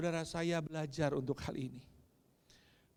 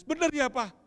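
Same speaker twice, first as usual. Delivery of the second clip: hopeful daughter; 9 semitones higher, 7 dB louder than usual. Retold or imitated. Crying daughter asks hopefully.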